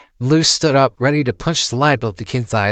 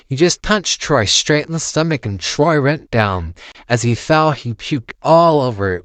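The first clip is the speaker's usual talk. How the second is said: ordinary delivery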